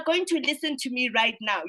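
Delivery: ordinary talk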